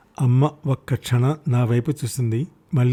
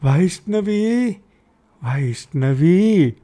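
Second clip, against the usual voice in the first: calling out; 6 semitones higher, much slower than usual. Acted. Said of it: "గట్టిగా పిలిచింది వదినని".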